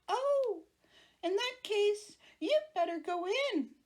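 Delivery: high squeaky monster voice. Acted. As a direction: cheerfully give in, squeaky. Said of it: "said the monster".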